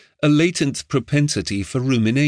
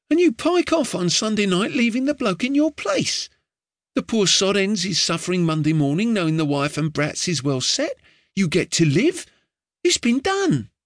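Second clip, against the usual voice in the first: put-on higher boyish voice, earnest and chatty; a street boy urging his doomed master, higher; eager boy Ned; 7 semitones higher than usual. A narrator's reading. Street boy giving his master earnest advice, higher boyish voice. Ned explaining escape scheme.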